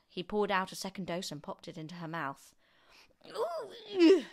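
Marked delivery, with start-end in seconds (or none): 3.3-4.2 long, loud rising squeal; high scream. A narrator's reading. Grandma gets her second dose, high scream. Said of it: "she screamed".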